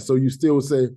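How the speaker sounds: usual speaking voice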